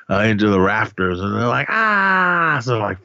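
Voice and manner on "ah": high, drawn-out mock scream. Retelling friends seeing Richie hoisted up, mock scream. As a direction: imitate terrified screaming without the volume.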